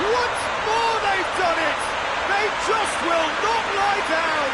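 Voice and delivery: exultant goal call. Real, not acted. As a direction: erupt as the goal goes in, then stay high. Commentator calls a goal.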